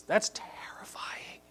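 hushed, slow whisper; awed whisper. Real, not acted. Preacher reacts to the demon's words, awed whisper. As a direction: drop to a drawn-out whisper.

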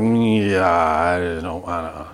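low, trailing, reluctant mumble; imitating a kid who would rather not; squeamish reluctance. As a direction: let the refusal sag and drag out slowly. Kids' reply to mixing raw pork.

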